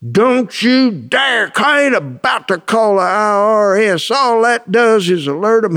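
mock furious refusal; retold angry explosion. Accountant refusing to call the IRS, imitated outburst. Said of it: "Boy, he just exploded on me".

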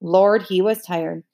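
ordinary delivery, nothing marked

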